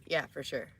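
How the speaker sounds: normal talking voice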